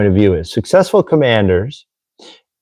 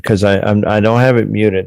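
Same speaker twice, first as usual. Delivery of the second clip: ordinary talk, nothing marked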